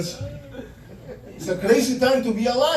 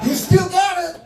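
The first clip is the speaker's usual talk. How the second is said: high put-on boxer voice, a touch louder; Tyson impression; 10 semitones higher, 4 dB louder than usual. Comic praises the boxer in his voice, high and put-on. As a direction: do the champ's high voice. Imitating Mike Tyson after video.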